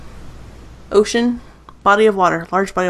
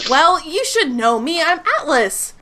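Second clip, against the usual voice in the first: higher, chest-puffed boast; 8 semitones higher than usual. Acted. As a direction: brag like he's famous. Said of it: "huffs his chest".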